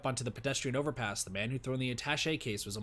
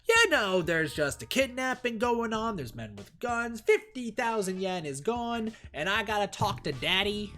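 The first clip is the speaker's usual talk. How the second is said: sarcastic rant; 8 semitones higher, 4 dB louder, slower than usual. Acted. Sarcastic rant about the dad.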